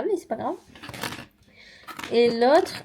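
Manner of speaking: normal talking voice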